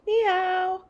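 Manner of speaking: put-on high, bright child's greeting. Girl answering the restaurant owner, high child voice. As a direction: say it back like a little girl.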